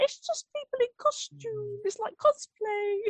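high little voice, slowing at the end; dismissive boy. Retold or imitated. Imitating Noah among freaked-out adults, high-pitched dismissal.

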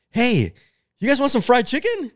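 high, chirpy imitation of the roommate; high mock bro voice. Roommate voiced offering chicken.

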